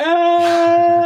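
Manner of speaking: sudden high yelp of fright; a short startled shriek; mock scream. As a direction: yelp like you're falling out of a plane. Scream while learning to skydive.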